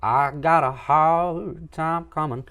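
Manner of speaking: high, slow, loud blues wail; blues singer voice. Shown as a demonstration. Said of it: "blues singer would be like".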